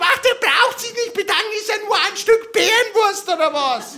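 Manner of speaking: mock saleswoman. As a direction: play the butcher's saleswoman in a high fake-sweet voice. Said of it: "Und hat gesagt".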